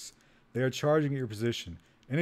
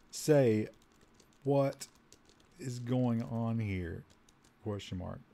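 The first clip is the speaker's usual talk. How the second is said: word by word, sinking softer at the end; slow dictation; 4 dB quieter, much slower than usual. Real, not acted. Player typing a question to the room, slow dictation. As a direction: read it out as you type. Dictating typed input with punctuation.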